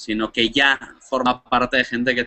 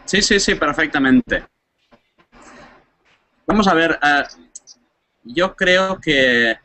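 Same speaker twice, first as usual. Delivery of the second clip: raised, higher voice over a bad line; shouting on bad phone line; 4 semitones higher than usual. Real, not acted. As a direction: call out so the caller hears. (gritando por una mala línea telefónica)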